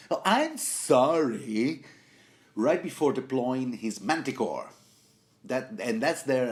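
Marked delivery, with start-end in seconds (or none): 0.1-1.8 drawn-out sarcastic "oh, I'm sorry", higher and louder, slow; sarcastic apology. Retold or imitated. Podcaster voicing the assassin's sarcastic apology before striking. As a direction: oversell the apology you don't mean. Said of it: "maybe so sarcastic".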